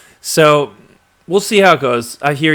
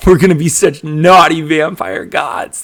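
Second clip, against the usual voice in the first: louder, higher quoted film line; hammed-up vampire movie quote; mock movie sidekick; 5 semitones higher, 8 dB louder than usual. Host quoting a favorite Blade line, hammed-up sidekick.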